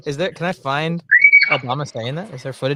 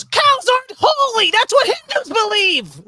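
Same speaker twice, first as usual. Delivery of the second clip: put-on much higher, louder scolding girl voice; indignant young girl; 5 dB louder than usual. Wife as a girl correcting her brother, high indignant scold. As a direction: squeak it out like an outraged twelve-year-old. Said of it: "And she goes".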